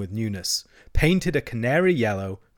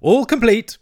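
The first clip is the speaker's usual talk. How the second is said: high, loud, proud Toad; triumphant; 11 semitones higher, 9 dB louder than usual. A narrator's reading. Toad showing off the caravan's stores, triumphant. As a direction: crow with satisfaction. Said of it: "said the toad triumphantly".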